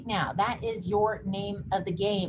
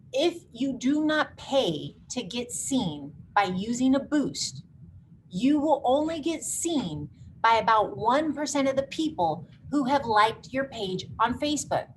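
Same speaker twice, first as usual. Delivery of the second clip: deliberate, word-by-word warning; slow and emphatic; 3 semitones higher than usual. Real, not acted. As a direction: spell it out slowly so they really hear it. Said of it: "I'm gonna say this real slow".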